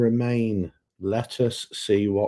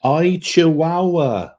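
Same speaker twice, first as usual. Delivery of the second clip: Welsh accent; 8 semitones higher, 6 dB louder, much slower than usual. Shown as a demonstration. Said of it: "in a Welsh accent".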